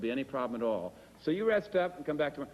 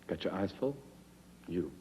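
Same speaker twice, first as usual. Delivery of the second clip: soap-opera lover; 9 semitones lower, 7 dB quieter, faster than usual. Acted. Repeating audition take.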